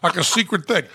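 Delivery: high, giggling teasing; laughing. Real, not acted. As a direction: tease through laughter. Teasing friend about whispering.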